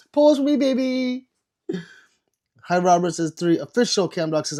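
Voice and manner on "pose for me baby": mock flirty. Flirty reply to a chat viewer.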